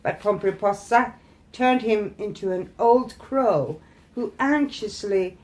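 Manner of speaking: ordinary delivery, nothing marked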